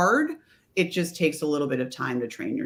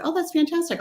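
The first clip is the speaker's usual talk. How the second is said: much higher, gushing retold praise; delighted reply; 12 semitones higher than usual. Trainer replies to technician who uses her book, delighted. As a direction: act out an enthusiastic response, pitched right up.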